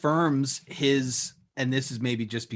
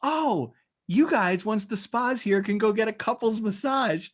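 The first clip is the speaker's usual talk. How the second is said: chirpy imitation; 8 semitones higher than usual. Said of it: "And then she goes".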